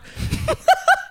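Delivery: shrill cackling laugh; high burst of laughter. Real, not acted.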